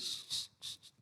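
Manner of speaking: soft hissed shh pulses; voiceless little warning-light sound; imitated signal noise. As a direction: make quiet, repeated hushing noises.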